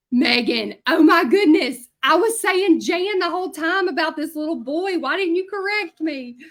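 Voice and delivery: hammed-up "why didn't you tell me"; mock exasperated outburst. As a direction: playfully protest to a friend in embarrassed disbelief. Acted mortified outburst to co-teacher.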